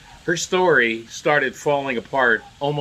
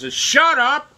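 high mocking yell, imitating the angry press; hammed-up indignant shout at the questioners; 9 semitones higher than usual. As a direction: sneer it out like a furious pundit. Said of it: "They're so angry".

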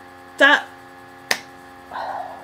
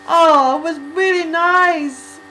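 gushing; 7 semitones higher, 9 dB louder, slower than usual. Real, not acted. Gushing over the song.

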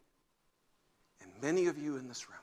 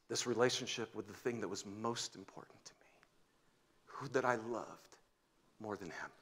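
hushed confession; 4 semitones lower, 5 dB quieter than usual. Retold or imitated.

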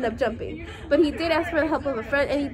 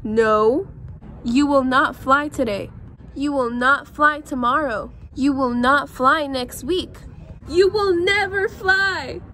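slow, firm, deliberate refusal; Elephant, stern; 4 dB louder, much slower than usual. Acted. Elephant firmly tells Piggy he won't fly, slow and stern. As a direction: lay down the law slowly like a big stern elephant.

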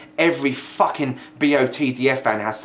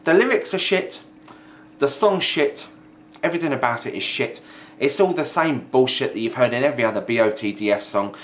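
ordinary talk

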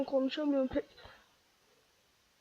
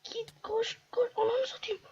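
frantic whisper; 8 semitones higher than usual. Real, not acted. Fleeing in panic.

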